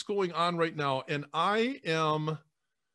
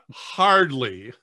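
said through a laugh; 8 dB louder than usual. Real, not acted. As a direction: laugh it off, modestly. Laughing off a compliment.

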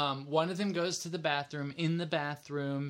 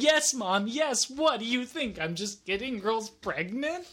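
defensive; 6 semitones higher, 3 dB louder than usual. Retold or imitated. Retold defensive reply to mom.

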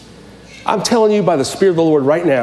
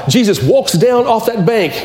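ordinary talk, nothing marked

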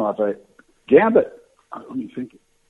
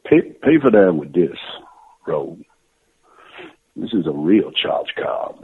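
put-on Cajun accent. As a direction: whisper it like a Cajun charmer. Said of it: "He said".

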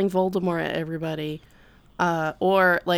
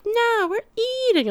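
tag. Retold or imitated. Diners refusing the chef, acted out.

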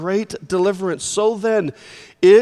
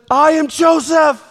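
much higher and louder, bursting out; emotional outburst; 9 semitones higher, 7 dB louder than usual. Acted. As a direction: let it all flood out at once. Joseph's emotion floods out.

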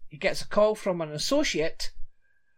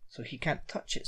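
hushed whispered aside; whispering; 3 semitones lower, 11 dB quieter than usual. Real, not acted. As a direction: drop to a whisper. Aside after reading recap.

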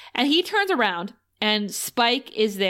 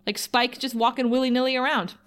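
ordinary talk

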